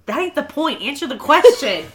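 exasperated demand. Retold or imitated. Retelling Daisy cutting off his excuses, mock exasperated. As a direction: imitate an exasperated girlfriend demanding answers.

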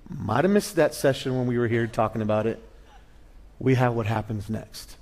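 ordinary talk, nothing marked

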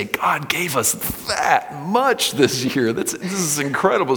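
amazed worshipper. Retold or imitated. Worshipper marvelling at God's gift, lightly voiced amazement. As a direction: voice the giver's wide-eyed delight.

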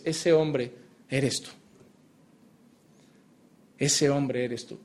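más bajo y lento, señalando al oyente; solemn hushed accusation. Acted. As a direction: dilo despacio y en voz baja, como una sentencia. Solemn confrontation of the listener. (acusación solemne, baja y lenta)

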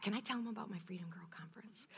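quick whispered aside to the host. Real, not acted. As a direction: sneak the question in quietly.

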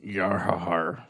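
slow, low pirate growl. Pretend. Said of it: "[name] could sing like a pirate".

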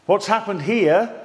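ordinary talk, nothing marked

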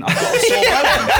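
can't get through it without laughing. Shown as a demonstration. Joking to friends, bursting into laughter on the phrase. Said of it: "that's as funny as saying".

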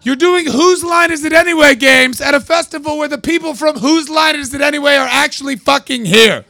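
high loud furious shout at the improv troupe; angry yell. Pretend. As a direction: pretend telling off, shouted high. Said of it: "going to that show and saying".

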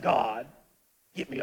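low mock-biblical voice; deep-voiced Paul. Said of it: "he probably had to be deep voice".

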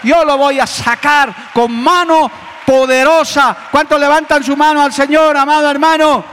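loud, high, fervent sermon; full-force preaching. Acted. Pastor exhorting the congregation, loud and fervent. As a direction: drive every line home to the pews.

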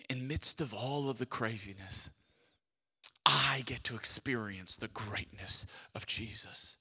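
low whispered wonder; awed whisper. Retold or imitated. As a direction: breathe it in hushed awe. Joseph voiced, whispered.

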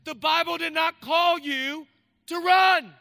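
loud, high, driving sermon cadence; full-force preaching. Acted. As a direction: preach it to the back row.

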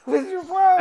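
high mock tough voice. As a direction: squeak out a mock challenge. Repeats high voice.

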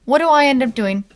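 usual speaking voice

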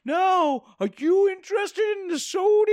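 put-on high, slow, eager old man voice; drawn-out creepy welcome, high and slow; high old-timey shopkeeper. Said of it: "my new character".

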